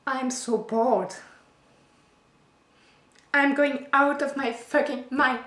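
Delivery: frustrated outburst. Acted. Bored wife at the end of her rope, loud exasperated outburst.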